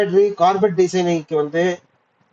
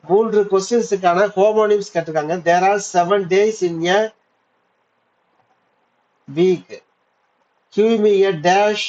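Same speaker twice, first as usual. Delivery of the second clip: ordinary delivery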